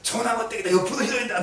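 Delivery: imitating astonished relatives; retold family shock. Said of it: "家族みんな全員びっくりしたんですよ".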